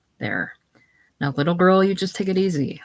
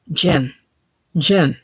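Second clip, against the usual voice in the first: louder, rising cry of his name; calling out; 5 dB louder than usual. Acted. Frightened Eva cries out to Jim as he enters. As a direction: cry out for him in relief.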